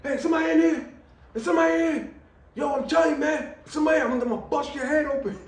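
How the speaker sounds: threatening call out. Retold or imitated. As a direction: shout a bluff threat at an unseen intruder. Calling out holding crowbar.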